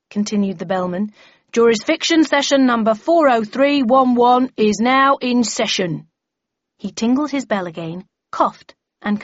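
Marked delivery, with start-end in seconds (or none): 1.5-6.1 loud, ringing proclamation; town crier announcement. A narrator's reading. Bellman formally opens the meeting, proclaiming. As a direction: proclaim it to the whole room.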